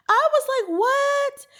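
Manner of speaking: shocked laugh. Real, not acted. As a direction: squeal it in disbelief. Laughing disbelief at the accident.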